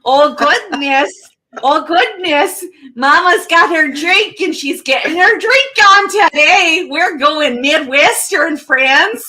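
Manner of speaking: slow, drawn-out folksy Midwestern twang; Midwestern accent. Acted. Host opening the show in a mock Midwestern accent. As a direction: put on a homey Midwestern mom. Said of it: "I'm not used to doing the Midwestern accent".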